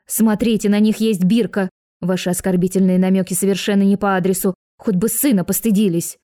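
plain reading voice

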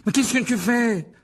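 high, loud panicked shout; alarmed exclamation. A narrator's reading. Malfoy fleeing as Harry charges at him, alarmed shout. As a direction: cry out loudly, high and stretched. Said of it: "s'exclama-t-il en s'enfuyant".

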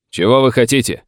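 carrying challenge from afar; shouted reply. A narrator's reading. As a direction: call the question back curtly. Sheriff shouts back across distance.

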